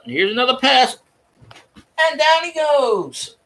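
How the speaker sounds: high, hammed-up sports commentator voice calling sacks; mocking play-by-play. Mocking Cam Newton as a hammed-up play-by-play announcer. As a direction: call each sack like an over-excited announcer, stretching "And down he goes". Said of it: "Here's my impression of Cam Newton".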